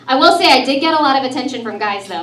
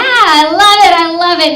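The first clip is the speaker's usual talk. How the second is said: excited; 5 semitones higher, 7 dB louder than usual. Real, not acted. Excited reply to crowd cheer.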